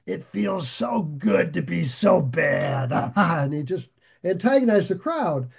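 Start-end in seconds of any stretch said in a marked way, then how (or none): 0.1-3.1 villain gloating. Retold or imitated. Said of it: "he came up and he said".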